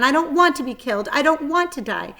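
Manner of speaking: high, loud frightened pleading; panicked. Acted. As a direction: beg in rising panic. Wilbur panics about dying.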